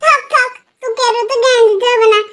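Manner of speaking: loud, sharp, brushing him off; loud snapping retort. Acted. Sister cutting off her preachy cousin, loud and sharp. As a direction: shut down the know-it-all cousin loudly.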